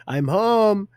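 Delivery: mock greeting. Acted arrival greeting in retold scene.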